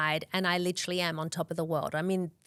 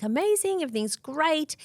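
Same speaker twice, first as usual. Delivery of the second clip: high, bright mock-chirpy reply; breezy cheerfulness; 8 semitones higher than usual. Telling the obstetrician she feels great, breezy and upbeat. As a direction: sing it out like nothing could be wrong.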